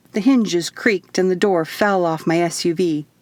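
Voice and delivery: usual speaking voice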